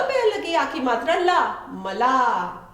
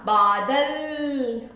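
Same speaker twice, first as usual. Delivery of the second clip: slow stretched बादल with long बा; drawn-out word; much slower than usual. Shown as a demonstration. Teacher to children, slowly blending बादल. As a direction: sound out बादल syllable by syllable. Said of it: "बन गया".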